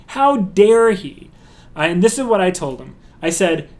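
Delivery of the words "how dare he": "How dare he?" slowed way down, higher and louder; outraged. Real, not acted. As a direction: draw out every word of "How dare he?" in outrage. Repeats it more strongly.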